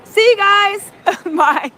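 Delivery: high, loud cheery farewell with a laugh; mock goodbye. Host jokes about leaving, loud laughing bye. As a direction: pretend to bail out laughing.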